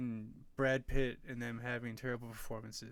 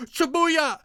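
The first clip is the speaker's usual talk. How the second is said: much higher, shouted mock Jamaican hype call; hammed-up Jamaican accent shout; 9 dB louder than usual. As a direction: yell it like a bad Jamaican accent on the red carpet. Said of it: "keeps talking in this, like, Jamaican accent".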